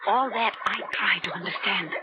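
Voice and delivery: usual speaking voice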